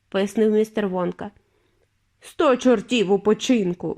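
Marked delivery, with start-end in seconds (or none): 2.2-3.9 exclamation. A narrator's reading. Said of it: "вигукнув пан Тіві".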